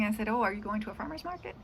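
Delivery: ordinary talk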